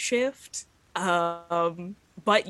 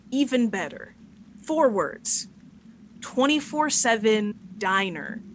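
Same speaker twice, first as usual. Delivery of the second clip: emphatic announcement; 4 semitones higher, 4 dB louder, slower than usual. Acted. Luna pitches an idea to everyone.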